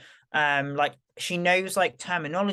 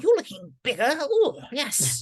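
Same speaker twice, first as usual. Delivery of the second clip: high-pitched cooing, imitating mum; playful mock admiration; admiring mum; 8 semitones higher than usual. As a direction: gush like an impressed mum. Mum's admiring comments imitated.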